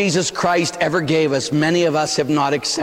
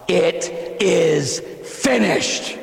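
drawn out very slowly; slow it is finished; slow declaration; much slower than usual. Acted. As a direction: pronounce it slowly and with finality. Jesus' triumphant repeat.

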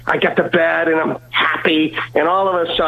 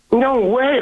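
higher-pitched, amazed exclamation; mock disbelief, pitched up; retold amazement; 9 semitones higher than usual. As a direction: imitate an astonished woman. Amazed clerk voiced in story.

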